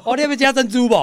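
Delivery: loud, high teasing sarcasm; sarcastic joke. Pretend. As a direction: tease with mock offer.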